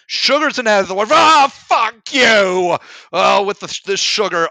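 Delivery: loud, high exasperated outburst; real annoyed shouting at the problem; frustrated shout. Real, not acted. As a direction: yell at the word in real frustration. Frustrated outburst at the sugar word.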